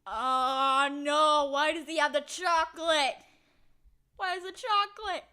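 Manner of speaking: high, loud shocked yelling; loud disbelief. Real, not acted. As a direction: yell it in horrified disbelief. Cat holds the chocolate.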